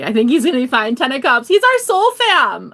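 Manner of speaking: building to a high, loud holler; whooping enthusiasm; excited cheering. Real, not acted. Cheering on Bigfoot over cards.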